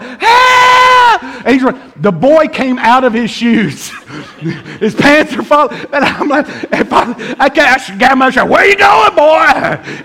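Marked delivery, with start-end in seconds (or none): 0.0-1.2 high, loud, long mock scream; screaming for help. Chubby kid fleeing the preacher, drawn-out scream for help. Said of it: "he is screaming for help". 8.5-10.0 high, loud shout mid-chase; chasing holler. Acted. Man grabbing a fleeing kid, hollered taunt.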